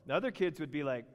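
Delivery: ordinary talk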